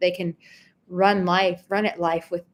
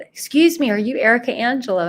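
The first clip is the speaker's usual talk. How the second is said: excited fan; 6 semitones higher, 6 dB louder, faster than usual. Retold or imitated. Fan greeting her excitedly in restaurant.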